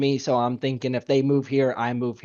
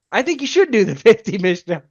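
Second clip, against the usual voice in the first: higher and laughing through the words; 8 semitones higher, 6 dB louder than usual. Retold or imitated. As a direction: push the fifty with a giggle. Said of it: "You're like".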